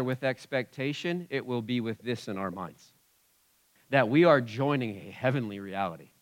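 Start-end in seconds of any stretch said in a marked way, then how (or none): none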